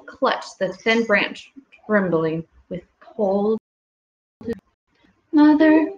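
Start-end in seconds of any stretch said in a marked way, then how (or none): 5.3-5.9 high, louder little squeak; a lost baby bat squeaking for mother; squeaky call. A narrator's reading. Baby bat calls for her mother, high squeak. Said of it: "Stella Luna squeaked".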